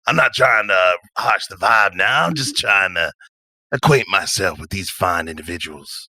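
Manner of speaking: easygoing character talking his way out; smiling, unbothered self-defence; laid-back drifter. Acted. Patch placates an angry Nina, laid-back. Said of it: "Patch smile and just kinda lead back".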